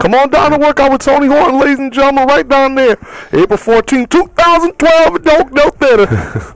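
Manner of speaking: high, hammed-up country accent pitch. Host invites listeners to the workout event, hammed-up country accent. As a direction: play a country announcer drumming up a crowd. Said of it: "about as best as my country accent".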